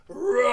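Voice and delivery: loud, high playful roar shouted out; kid-friendly lion roar; big roar. Shown as a demonstration. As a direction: roar like a lion at full force. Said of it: "I want you to do a big roar".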